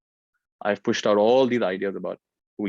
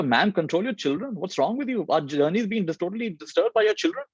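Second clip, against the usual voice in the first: high, angry scolding of a stranger; mock-yell at the careless mother; retold angry shout; 9 semitones higher than usual. Angry man on the train scolds a mother, high-pitched shout. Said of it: "he finally shouts at her".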